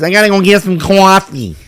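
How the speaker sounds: high put-on Long Island accent. Friend Andrea ordering coffee in a Long Island accent. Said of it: "When she talks".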